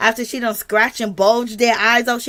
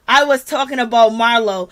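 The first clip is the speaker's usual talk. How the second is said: usual speaking voice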